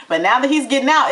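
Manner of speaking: normal talking voice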